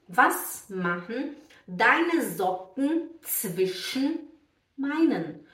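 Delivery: very slow, spaced-out German model; word-by-word reading with swallowed -en endings; example sentence. Shown as a demonstration. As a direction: say the German slowly and distinctly for learners. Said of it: "O som sai nasal".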